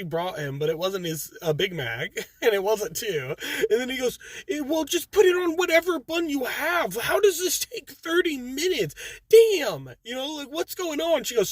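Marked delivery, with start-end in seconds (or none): 4.5-11.2 high, rising irritation, imitating him; exasperated customer. Customer snapping at the McDonald's manager, mildly exasperated. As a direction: play the fed-up customer pushing back. Customer loses patience, cusses.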